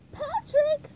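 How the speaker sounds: high, loud, exasperated cry; squeaky cartoon wail; SpongeBob. A narrator's reading. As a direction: cry out a friend's name in dismay. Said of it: "SpongeBob cried".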